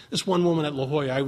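ordinary talk, nothing marked

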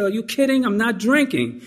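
high-pitched, incredulous retort; incredulous refusal. Retold or imitated. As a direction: act out disbelief at a silly suggestion. Retold refusal of wife's invitation.

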